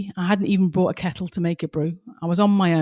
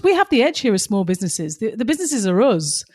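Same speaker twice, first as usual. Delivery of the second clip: normal talking voice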